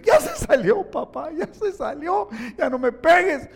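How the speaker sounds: high, loud pleading yelp in a put-on kid voice; frightened boy shrieking at his father; screaming child. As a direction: beg like a beaten boy. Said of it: "el hijo empieza a gritar".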